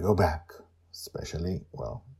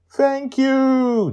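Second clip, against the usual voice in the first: high, loud joyful cry; beaming; 11 dB louder than usual. Acted. Joy overjoyed to see the tortoise, high and bright. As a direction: beam with delight. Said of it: "Joy beams".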